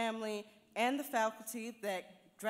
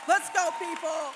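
loud rallying call to the crowd; 6 semitones higher, 7 dB louder than usual. Real, not acted. Graduate rallying classmates with a loud cheer. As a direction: pump up the whole class.

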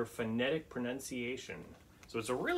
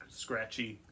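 plain reading voice

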